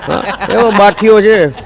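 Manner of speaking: slow words through laughter; laughing. Real, not acted. Comedian to audience, laughing about Raju's stubbornness. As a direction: chuckle while calling him stubborn.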